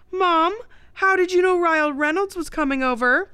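high, loud mock amazement; joking. A narrator's reading. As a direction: tease mom with exaggerated excitement. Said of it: "Billy joked".

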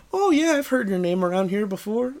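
high-pitched imitation of a chatty caller; imitated friendly caller. Woman on the phone greeting a new caller, imitated friendly.

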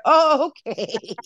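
high, long drawn-out mock acceptance; exaggerated comic backing-off; taken aback. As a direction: stretch it out, high and stunned. Acted reaction to mother's put-down.

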